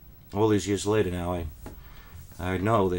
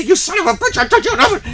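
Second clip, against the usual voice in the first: very high, loud furious screech with a laugh; furious curse; 11 dB louder, faster than usual. Retold or imitated. Teacher cursing the boy mid-beating, shrieking loud.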